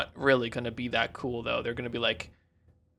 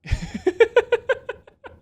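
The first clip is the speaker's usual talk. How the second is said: loud, high burst of laughter; high giggling outburst; high-pitched laugh; 5 dB louder than usual. Real, not acted. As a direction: laugh high up, well above speaking voice. Amused while searching for a face.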